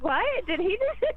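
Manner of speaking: high, stunned disbelief; shocked surprise. Retold or imitated. She repeats her shocked reaction to Doug, high and stunned. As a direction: blurt it out, scandalized. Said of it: "I was like".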